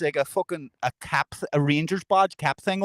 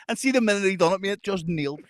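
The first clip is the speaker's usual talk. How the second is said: ordinary talk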